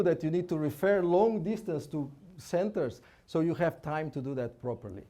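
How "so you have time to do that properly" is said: ordinary delivery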